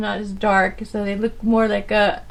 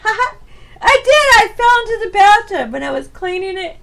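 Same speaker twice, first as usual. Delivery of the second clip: high, loud, laughing insistence; laughing admission; 8 semitones higher, 8 dB louder than usual. Real, not acted. Vlogger confesses falling into the bathtub, really laughing. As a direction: burst out laughing and insist loudly.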